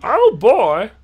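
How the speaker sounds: loud, high, exaggerated American "oh boy"; mock American accent. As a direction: overdo a fake American accent. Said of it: "Ben's one hundred percent accurate, uh, American accent".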